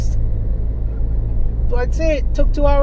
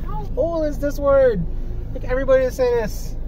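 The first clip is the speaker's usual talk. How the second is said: surprised; 9 semitones higher than usual. Retold or imitated. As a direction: ask it in amazement. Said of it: "And I'm like".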